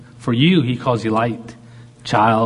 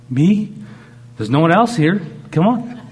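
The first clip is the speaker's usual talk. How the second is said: eager invitation; 6 semitones higher, 3 dB louder than usual. Acted. God urging the believer in.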